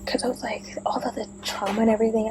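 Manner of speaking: fast unvoiced whisper. Real, not acted. Vlogger whispering about her trauma. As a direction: rush it out in a hushed whisper.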